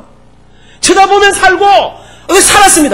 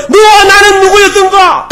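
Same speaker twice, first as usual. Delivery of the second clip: slow, ringing, louder proclamation over music; a grand, drawn-out sermon cry; proclaimed; 9 semitones higher, 7 dB louder, slower than usual. Acted. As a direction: call it out slowly like a song of the ages. Preacher proclaims Moses' song.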